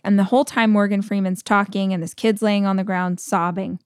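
ordinary delivery

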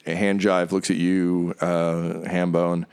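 ordinary talk

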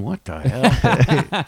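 very slow, drawn-out disbelief; comic stunned reaction; retold bewilderment. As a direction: stretch it out, dumbfounded. Retold bewilderment watching signings.

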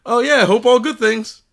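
high, loud, overly cheery comeback; over-eager reply. Retold or imitated. Caller replying to a stranger who knows his name, over-eager. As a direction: re-enact trying too hard to be friendly.

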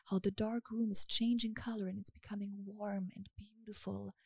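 steady hypnotic guidance; hypnosis script. Acted. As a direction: lull her gently into calm. Hypnosis session guiding listener.

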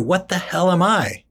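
ordinary talk

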